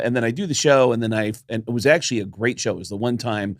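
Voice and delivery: usual speaking voice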